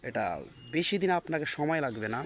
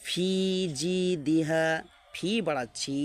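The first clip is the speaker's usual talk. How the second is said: ordinary delivery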